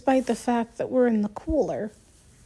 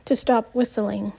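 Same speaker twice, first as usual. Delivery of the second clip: ordinary delivery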